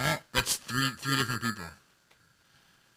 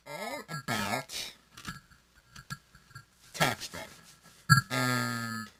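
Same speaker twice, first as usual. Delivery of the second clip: slow, stiff robot voice; crappy robot voice; 3 semitones lower, 4 dB quieter, much slower than usual. Acted. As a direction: talk like a broken robot. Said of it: "in my best crappy robot voice".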